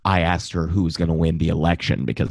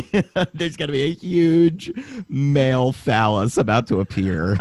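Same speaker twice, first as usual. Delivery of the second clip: laughing; 6 semitones higher than usual. Real, not acted. Joking about expected dick pic.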